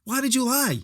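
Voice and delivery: high-pitched exasperated rant. Real, not acted. Podcaster rants at a movie kid's lie, exasperated. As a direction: demand to know why he lied.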